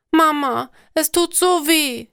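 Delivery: high, acted plaintive little girl; weeping child. A narrator's reading. Little girl cries to her mother in pain, plaintively. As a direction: wail mildly to mum, pitched well up. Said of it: "Alina weint wieder".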